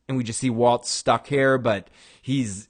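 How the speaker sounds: ordinary talk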